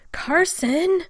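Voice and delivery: high, loud screaming the name; near scream. A narrator's reading. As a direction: scream his name in panic. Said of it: "came out almost as a scream".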